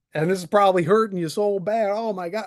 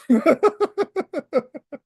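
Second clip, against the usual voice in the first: mocking laugh; 8 semitones higher, 4 dB louder than usual. Real, not acted.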